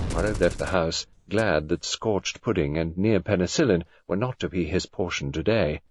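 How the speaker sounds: plain reading voice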